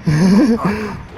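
laughing. Real, not acted. Laughing at bad luck.

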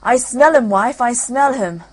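excited ogre sniffing; loud insistent ogre. Acted. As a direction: press it on your wife, booming.

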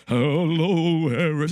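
loud, looming horror-host greeting; Vincent Price impression. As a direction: put on a spooky Vincent Price voice. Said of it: "sounds like Vincent Price".

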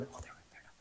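hushed gossiping whisper; barely audible murmured aside; mock whispering. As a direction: whisper it behind someone's back. Onlookers whispering about foreigners.

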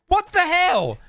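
high, loud disbelieving yelp; shocked outburst. Real, not acted. Reacting to rude seller reply.

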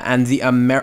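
ordinary delivery

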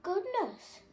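high, bright twitter. A narrator's reading. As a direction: twitter like a little bird. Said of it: "twittered Muriel".

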